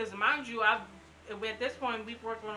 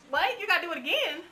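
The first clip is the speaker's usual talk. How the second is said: sharp high disbelief, louder on What; can't believe they want more blood; incredulous surprise; 8 semitones higher, 5 dB louder than usual. Retold or imitated. Patient to nurse, retelling her shocked disbelief. Hearing the blood test again.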